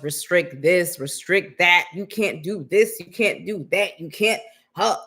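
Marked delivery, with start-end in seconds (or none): none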